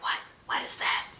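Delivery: put-on hushed, wide-eyed whisper of surprised children; curious kids whispering. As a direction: whisper it like kids hearing a strange knock. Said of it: "their eyes are like".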